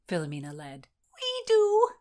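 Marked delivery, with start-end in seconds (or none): 1.1-1.9 very high, loud squeal. A narrator's reading. Constance squeals the answer to Philomena, very high. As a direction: squeal it with glee. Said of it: "squealed Constance".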